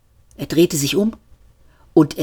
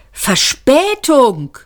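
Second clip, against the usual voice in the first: higher, slow mocking exclamation; called out; 7 semitones higher, much slower than usual. A narrator's reading. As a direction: throw the word back in disbelief. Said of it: "rief er". (Seyfort ruft ungläubig, langsam und höher)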